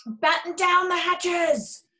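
high, very slow shout; captain shouting orders; storm order. A narrator's reading. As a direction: bark it over the wind.

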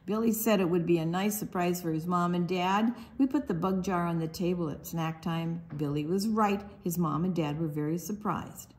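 ordinary talk

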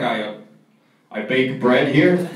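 low, halting mock-nervous admission. Friend admitting his job to comedian, mock-nervous. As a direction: imitate a friend reluctantly owning up, stumbling at first. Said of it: "He got, like, visibly nervous".

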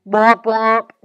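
loud, buzzing robot womp womp. Acted. Robot Clank answering the insult, loud womp. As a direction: blare it like a sad robot.

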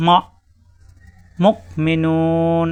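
slow, carefully recited মুকমিনুন with a firm stop on the sakin; recited Arabic drill. Shown as a demonstration. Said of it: "এরকম". (ধীরে, সাকিনে চাপ দিয়ে মুকমিনুন পড়া)

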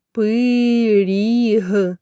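"пиріг" stretched on its vowels; sounded-out word. Shown as a demonstration. Teacher looking for the next sound, drawn-out "пиріг". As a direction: say "пиріг" slowly, each sound held. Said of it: "розібрати на звуки слово".

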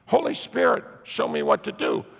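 high, urgent plea from the heart; desperate prayer. Retold or imitated. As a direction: pray in a panic for help. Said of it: "get it from your heart".

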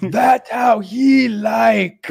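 high, slow, loud ape whine. Retold or imitated.

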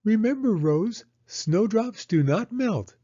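put-on high, slow child's voice; Penny telling her doll Rose, high and slow; little girl teaching her doll. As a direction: explain it to the doll like a tiny teacher. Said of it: "said Penny".

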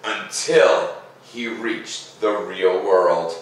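slow, lilting read-aloud voice; gentle storyteller pacing, each phrase drawn out; story narration. A narrator's reading. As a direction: read it like a bedtime story for kids. Reading the picture book aloud.

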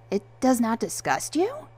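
incredulous, climbing question; bewildered. A narrator's reading. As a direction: ask it in bewilderment, pitch leaping at the end. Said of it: "Peter asked in bewilderment".

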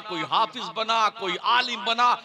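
normal talking voice

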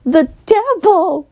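loud, slow, high horrified cry; scream. Acted. Morgana screaming at Jackie in horror. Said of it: "Morgana began screaming".

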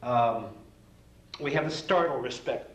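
ordinary delivery

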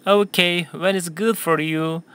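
slow, clear sentence for repeating; teacher modelling a question slowly for students; slow model reading. Shown as a demonstration. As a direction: read it slowly for the class to echo. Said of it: "한 번 더 할까요?".

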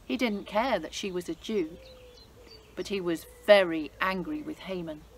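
lively, expressive storybook reading; story narration for children. A narrator's reading. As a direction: read it like a bedtime Bible story for kids. Reads Bible story to children.

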